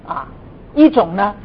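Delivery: normal talking voice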